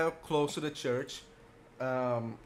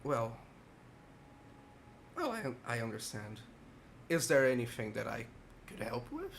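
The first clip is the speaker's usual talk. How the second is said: put-on very old man; 3 semitones lower, 7 dB quieter than usual. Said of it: "a very frail old man".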